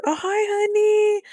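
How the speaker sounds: very high, drawn-out pet greeting; affectionate greeting. Real, not acted. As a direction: squeal a loud, slow hello to a pet.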